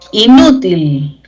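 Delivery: "Inútil" slowly, stressed on the middle syllable. Shown as a demonstration. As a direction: stretch and hit NÚ in "Inútil". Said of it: "lleva tilde por grave".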